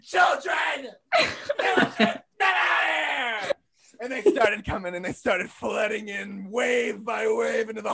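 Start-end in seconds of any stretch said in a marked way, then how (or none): 0.0-3.5 screaming. Retold or imitated. Storming a school hallway, screaming at the children. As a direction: yell it down the hallway. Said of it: "I screamed".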